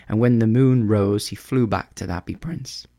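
usual speaking voice

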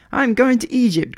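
high, loud announcement from a little bird; excited cry. A narrator's reading. Swallow tells the busy harbour, crying out excitedly. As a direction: call it out, pitched right up. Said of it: "cried the swallow".

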